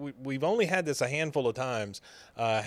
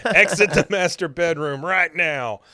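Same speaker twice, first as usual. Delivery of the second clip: louder, higher command broken by a laugh; stern mock order; 5 semitones higher, 7 dB louder than usual. Uncle ordering his niece out of the bedroom, mock outrage.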